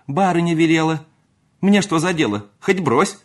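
high, loud, indignant retort; huffy, high-pitched servant girl; defiant maid. Acted. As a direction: answer back sharply and loudly. Maid quarrels back at footman.

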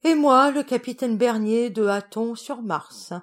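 ordinary delivery

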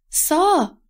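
high-pitched, urgent call; shouted direction. A narrator's reading. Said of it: "diye bağırdı Paul". (tiz sesle bağırarak)

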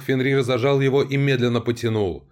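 ordinary delivery, nothing marked

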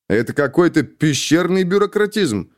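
a mild protest at petty bureaucracy; indignant. A narrator's reading. Young officer objecting to a colleague, indignant. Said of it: "возмутился Витвицкий".

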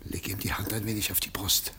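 low, hushed instruction; secretive whisper. Acted. Husband coaches his wife at the sickbed, whispering. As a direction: whisper it so the patient cannot hear.

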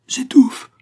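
loud, very high strangled gasp; choking. A narrator's reading. Dying old locksmith answering Malouin's demand, choking. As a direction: force it out through a closing throat. Dying man chokes out reply.

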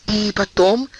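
the duke cutting off the queen, hurried; quick interruption. A narrator's reading. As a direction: jump in before she finishes. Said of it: "быстро перебил он её".

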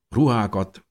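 usual speaking voice

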